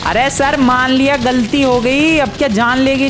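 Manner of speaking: high-pitched indignant plea; exasperated pleading. Acted. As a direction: beg the attacker to let it go, exasperated. Protesting to an attacker in game.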